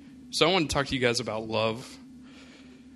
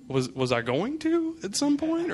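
mock alarmed question; 14 semitones higher than usual. Scared patient questions nurse.

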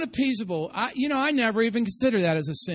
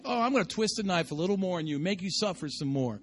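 mock cruelty; 5 semitones lower, 7 dB quieter, faster than usual. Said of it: "And then you're just like".

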